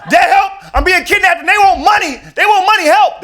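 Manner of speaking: high, loud frantic cry for help; panicked scream. Retold or imitated. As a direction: scream for your dad in terror. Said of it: "That's like something my dad would tell me".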